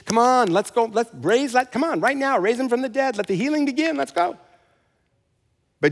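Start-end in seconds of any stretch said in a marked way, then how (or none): 0.1-4.4 higher and higher, hurrying someone along; impatient mock urging. Preacher pretending to push Jesus to skip the crying, rising impatience. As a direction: rush him like an impatient coach.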